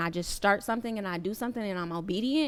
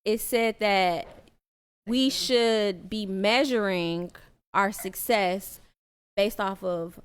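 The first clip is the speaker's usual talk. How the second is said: slow, weighty reading. Real, not acted. Reading prayer quote slowly.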